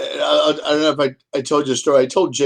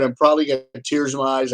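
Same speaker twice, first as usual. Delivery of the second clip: ordinary talk, nothing marked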